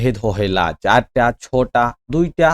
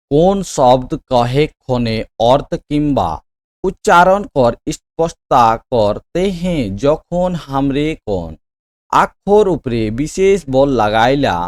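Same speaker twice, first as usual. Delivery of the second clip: very slow, projected reading aloud; drawn-out classroom dictation, firmly voiced; quiz question read-out; 4 dB louder, much slower than usual. Real, not acted. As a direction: spell out the question word by word. Repeats question slowly aloud.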